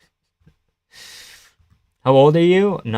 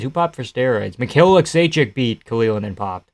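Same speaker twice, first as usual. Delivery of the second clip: ordinary talk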